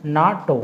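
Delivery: नाटो said as a single word, na-to, not letter by letter; as one word. Shown as a demonstration. Teacher showing students नाटो said as one word. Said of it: "नाटो ऐसे बोल रहा हूँ मैं".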